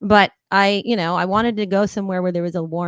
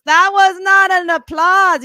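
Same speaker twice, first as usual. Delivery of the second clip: much higher, louder, slow mock scold; 10 semitones higher, 11 dB louder, slower than usual. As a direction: chide the crowd for weak applause. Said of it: "And he goes".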